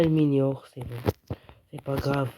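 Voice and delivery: ordinary talk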